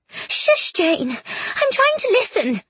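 breathy shush rising to high squeal; very high, put-on girlish protest; indignant shushing. A narrator's reading. Bronte's ghost shushing Jane while eavesdropping, indignant.

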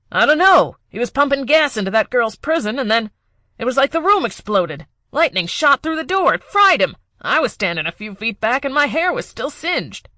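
high, loud, frantic account; panicked henchman. Acted. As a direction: rattle it off in panic.

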